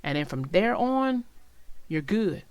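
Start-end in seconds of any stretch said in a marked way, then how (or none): none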